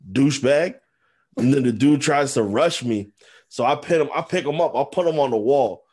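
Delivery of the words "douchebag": mock insult. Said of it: "And I'm like".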